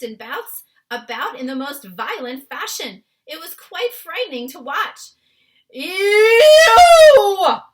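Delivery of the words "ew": long, high, very loud howl; giant's roar. A narrator's reading. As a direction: roar out a drawn-out cry of nightmare terror. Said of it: "roared the flesh lump eater".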